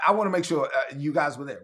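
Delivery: ordinary delivery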